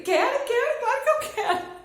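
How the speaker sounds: eager high, loud burst, laughing at the end; resposta eufórica imediata. Retold or imitated. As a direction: reenact jumping at the offer, loud and high. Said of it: "nem pensei pra responder". (resposta empolgada na hora, alta e aguda)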